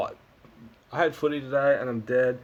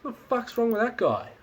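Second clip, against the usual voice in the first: bemused; 10 semitones higher than usual. Retold or imitated. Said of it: "Aussie Pie's like".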